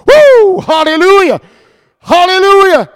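high, loud exultant whoops; shouted praise. Real, not acted.

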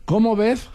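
sneering high lisp on ves; mock the Z spelling by lisping ves. Shown as a demonstration. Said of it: "Otra vez con Z".

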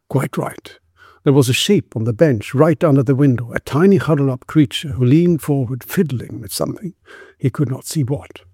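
usual speaking voice

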